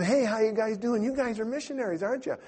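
high, put-on friendly voice; eager greeting. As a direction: greet strangers with exaggerated innocence. Feigned friendly approach to missionaries.

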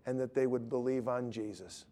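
low, hushed prayer voice; quiet closing prayer. Real, not acted. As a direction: pray softly and earnestly, dropping at each phrase end. Closes a prayer.

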